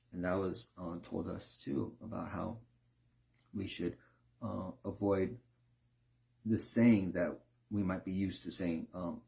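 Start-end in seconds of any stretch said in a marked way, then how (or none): none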